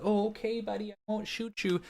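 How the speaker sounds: high, soft, overly polite Canadian; meek Canadian. Acted. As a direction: do a timid, apologetic Canadian in a squeaky-high voice. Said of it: "that's maybe the Canadian in me".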